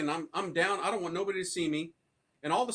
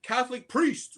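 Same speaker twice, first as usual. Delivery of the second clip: calling out, higher and louder; yelled out; 6 semitones higher, 4 dB louder, slower than usual. Retold or imitated. Calling out for a priest, higher and louder yell. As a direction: shout it down the hall. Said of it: "I yell out".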